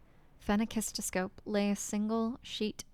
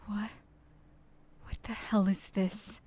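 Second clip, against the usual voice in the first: stunned whisper. Real, not acted. Reader baffled by letter.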